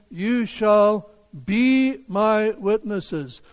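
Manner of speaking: slow, with "be" held long and set apart; stress on BE. Shown as a demonstration. Preacher quoting Jesus's command, heavy stress on "be". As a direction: lean hard on "be", drawing it out. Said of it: "the emphasis is on that verb".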